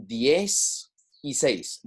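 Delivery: DIEZ Y SEIS slowly, as separate words; slow, separated words. Shown as a demonstration. Teacher splitting the number for Spanish learners, slow and separated. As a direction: break "diez y seis" apart, drawing it out. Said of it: "It's quicker".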